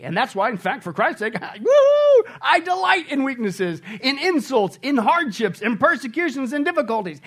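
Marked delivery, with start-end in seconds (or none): 1.4-7.2 loud, high whoop into elated listing; exuberant mock delight. Paul boasts of delighting in weaknesses, exuberant mock delight. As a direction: crow it with over-the-top joy.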